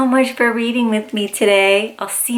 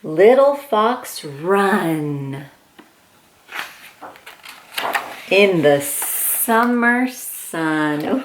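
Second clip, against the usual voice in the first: storybook reading; 4 semitones lower, much slower than usual. Acted. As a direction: read it gently like bedtime to a toddler. Reads picture book aloud.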